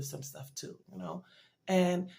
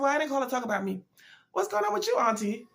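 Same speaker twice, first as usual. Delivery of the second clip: quick, much higher, louder, eager voice; bright caring self; 7 semitones higher, 6 dB louder, faster than usual. Retold or imitated. Retelling her phone call to her aunt, eager and much higher. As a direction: turn it brightly to the aunt, fast and high. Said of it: "And I said".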